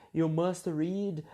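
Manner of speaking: ordinary talk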